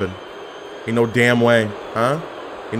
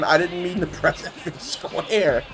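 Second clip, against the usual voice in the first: laughing through it; 4 semitones higher, 7 dB quieter, slower than usual. Real, not acted.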